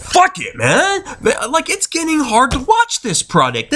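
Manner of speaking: high-pitched, fed-up griping; worked-up, frustrated rant; getting mad. Real, not acted. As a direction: throw up the complaint in exasperation. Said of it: "I'm sorry I'm getting mad".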